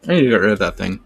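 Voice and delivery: talking with a mouthful; eating while talking. Real, not acted. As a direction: chew while you speak. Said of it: "Sorry, I'm eating and talking on the stream".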